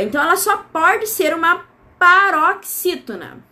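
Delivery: ordinary talk, nothing marked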